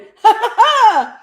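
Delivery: loud, high imitated laugh; big high-pitched mock laugh; excited laugh. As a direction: burst out laughing like the thrilled agent. Imitating the agent's delight.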